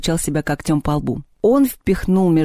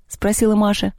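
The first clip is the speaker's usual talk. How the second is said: plain reading voice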